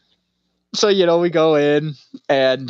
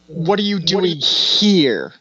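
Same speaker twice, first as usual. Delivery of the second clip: slow question; 2 semitones higher, slower than usual. Retold or imitated. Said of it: "And he goes".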